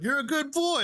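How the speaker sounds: very high, playful imitation; gleeful praise. Bandmate teasingly praising the fan, gleeful.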